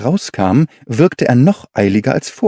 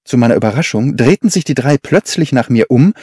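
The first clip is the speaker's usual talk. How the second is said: usual speaking voice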